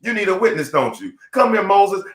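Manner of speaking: usual speaking voice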